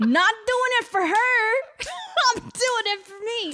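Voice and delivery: high, laughing defiant declaration; laughing mock defiance. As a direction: blurt it through laughter. Hammed-up defiant quote, laughing.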